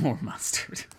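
breathy laugh through the words; giggling echo of the joke. Real, not acted. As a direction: repeat it while cracking up.

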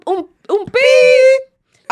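long high drawn-out bleep; playful bleep sound for the editor; imitated bleep. Shown as a demonstration. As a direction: mimic a censor beep, stretched. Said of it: "por favor, poner un".

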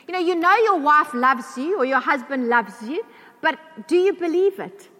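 ordinary delivery

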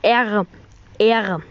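slow letter-by-letter spelling, repeated. Shown as a demonstration. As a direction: spell each letter with pauses. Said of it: "Ich wiederhole".

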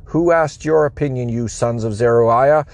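ordinary delivery, nothing marked

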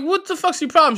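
high-pitched, exasperated demand; indignant retort. Retold or imitated. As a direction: act out an incredulous challenge, pitch jumping up. Retelling his side of the quarrel.

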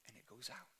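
whispered, dropping away to almost nothing; breathy hushed whisper. Shown as a demonstration. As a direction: whisper it like the flame just died. Flame doused on shield.

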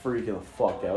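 ordinary delivery

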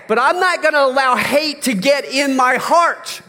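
high, loud impassioned sermon; fervent pulpit preaching; preaching at full force. Acted. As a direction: drive the point home to the congregation.

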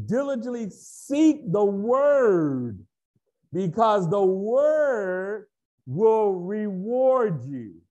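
"Word" drawn out long and stressed; heavy emphasis. Real, not acted. Preacher urging believers to seek, slow emphatic stress on "Word". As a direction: slowly hammer "seek the Word", stretching "Word". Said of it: "that's why we emphasize the Word".

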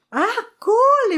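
high, thrilled "Ah, cool"; delighted teen. Retold or imitated. Teen girl delighted with her dad's gift, thrilled. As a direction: gush like a happy kid. Her reaction to giant overalls.

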